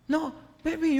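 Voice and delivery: ordinary delivery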